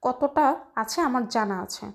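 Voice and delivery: ordinary delivery, nothing marked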